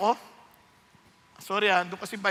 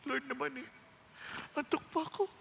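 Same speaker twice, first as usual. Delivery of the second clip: sleepy whine; 3 semitones higher, 10 dB quieter than usual. Retold or imitated. Sleepy reply to God's call.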